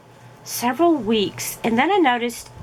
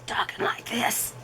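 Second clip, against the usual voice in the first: breathy whispered croak with no voice behind it; lost voice; 7 dB quieter than usual. Shown as a demonstration. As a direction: talk as if the voice is gone, barely a whisper. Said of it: "they could tell from my voice".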